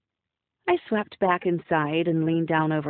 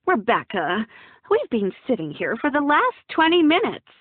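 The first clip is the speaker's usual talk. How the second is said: sharp scolding mother, raised pitch; reprimanding; 6 semitones higher, 4 dB louder than usual. Acted. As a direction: scold her late daughter. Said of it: "my mother reprimanded".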